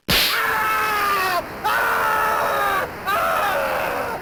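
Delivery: long, high, loud fake scream of pain. Shown as a demonstration. Demonstrating the fake-shock prank for the viewer, with a high, loud scream. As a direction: let out a scream they'll never forget. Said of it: "give 'em a scream they'll never forget".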